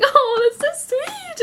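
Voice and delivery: very high, drawn-out coo; gushing swoon. Retold or imitated. Viewer swooning over the library gift, high gushing coo. Own reaction to romantic scene.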